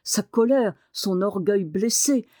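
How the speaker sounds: usual speaking voice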